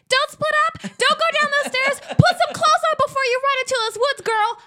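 high, loud horror-viewer yell; yelling at the screen. Acted. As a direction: shout frantic warnings at the movie characters. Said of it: "yelling at the T V screen".

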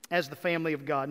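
ordinary talk

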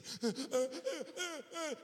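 high, hitching child's sobs, acted out; imitated hysterical sobbing. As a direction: mimic a little boy bawling uncontrollably. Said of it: "he's hysterical".

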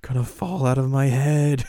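a touch of hammed-up cram panic; mock frantic Yui. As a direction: play Yui clutching her head, begging for silence. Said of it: "she rolls up and holding her head and is like".

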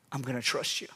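quiet I'm gonna trust you; quiet surrender. Acted. Surrender after dramatic pause.

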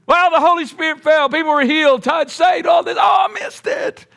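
wailing regret. Acted. Thomas moaning to the apostles about missing out, high wail. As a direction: act the disappointed latecomer, pitch way up. Thomas lamenting missed meeting.

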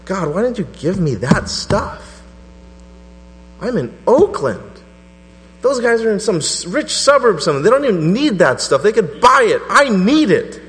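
high, loud, frustrated plea to God; jealous preacher's loud plea to heaven; pleading cry to God. Retold or imitated. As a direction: cry out to God in envious complaint. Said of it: "I was like".

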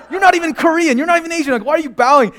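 can't believe he's bowing; incredulous. Retold or imitated.

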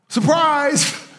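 high, bright mock "Surprise!"; spring it on them like a jack-in-the-box. Preacher springs the punchline on the congregation: high mock surprise.

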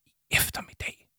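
hushed, puzzled echo; voiceless, uncertain query; questioning whisper. Acted. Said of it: "hviskede Arthur spørgende".

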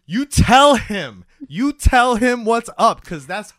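emphatic cheering on. Retold or imitated. Viewer cheering on the angry husband, slow emphatic approval. Host cheers on character while watching.

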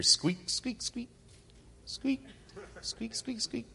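high, soft squeaky mouse noises; mouse squeaks. Retold or imitated.

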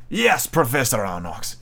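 a lightly voiced grave captain; Captain Nemo. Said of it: "Captain Nemo replied".

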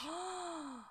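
sharp high gasp of shock. Real, not acted. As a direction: gasp as it clicks. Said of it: "I never connected that".

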